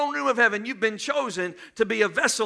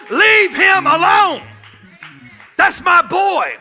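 booming, higher shout from heaven; God shouting; 7 semitones higher, 9 dB louder, slower than usual. Acted. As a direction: roar it down as the Father defending his child. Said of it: "voice of God ... that is shouting".

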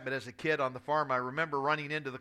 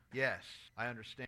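weak reply; 6 semitones lower, 10 dB quieter than usual. Retold or imitated. Dying grandfather answers weakly.

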